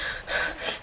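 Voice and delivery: fast, breathy mock panting; quick voiceless huffs; imitated hyperventilating. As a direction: pant as if hyperventilating. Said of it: "kinda hyperventilating".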